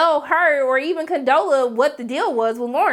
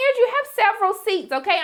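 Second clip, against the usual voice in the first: much higher, diva telling him to sit down; sassy put-down; 8 semitones higher than usual. Retold or imitated. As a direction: talk down to him with attitude. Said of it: "I was like".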